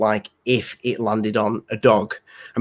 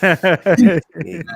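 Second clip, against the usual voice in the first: laughing; 4 semitones higher, 4 dB louder than usual. Real, not acted. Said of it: "The bit that I cry at every time".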